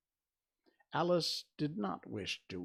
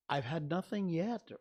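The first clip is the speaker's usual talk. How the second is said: mildly affronted, pitched up; reply with a touch of injured pride; offended; 7 semitones higher, 3 dB louder than usual. A narrator's reading. Alice answering the March Hare, offended. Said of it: "replied in an offended tone".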